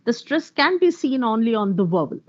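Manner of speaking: ordinary talk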